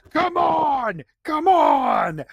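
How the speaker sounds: high, loud pleading shout at a dead car engine; frantic shout. Retold or imitated. Horror movie character yelling at a car that won't start, loud and high. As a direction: yell it like you're begging the car to start. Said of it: "they're like".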